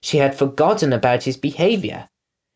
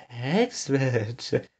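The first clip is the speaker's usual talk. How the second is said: soft, distant murmur of the name; 8 dB quieter than usual. A narrator's reading. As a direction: murmur it while looking away. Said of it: "murmured Winnie's mother".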